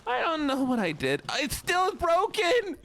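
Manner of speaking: high whiny complaining. Pretend. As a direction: whine about the broken game. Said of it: "I'm doing the wrong voice".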